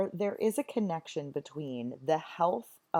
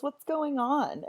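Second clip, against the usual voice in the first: higher, slow, lightly voiced worried lady; Lady Macbeth asking; 12 semitones higher, 3 dB louder, slower than usual. Teacher voicing Lady Macbeth arriving at the commotion, higher and slow. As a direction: draw it out high like a startled noblewoman. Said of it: "She says".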